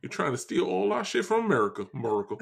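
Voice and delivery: blustery Southern cartoon grievance; Foghorn Leghorn. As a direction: argue like an indignant Southern gentleman. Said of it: "Foghorn Leghorn".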